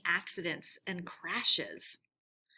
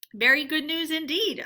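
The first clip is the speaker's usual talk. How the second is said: dubious; 6 semitones higher, 6 dB louder than usual. Acted. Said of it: "observed the rat dubiously".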